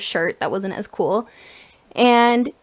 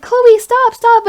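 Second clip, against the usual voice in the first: high, loud imitated shout; calling out; 12 semitones higher, 9 dB louder, much slower than usual. Sister chasing down the basketball star, calling out urgently. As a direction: yell after someone like an excited fan.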